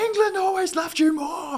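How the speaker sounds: very high, loud, whiny shout; hammed-up jealous kid yelling; sibling taunt. Kiwi voicing New Zealand taunting Australia, very high shout.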